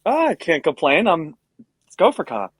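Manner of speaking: ordinary delivery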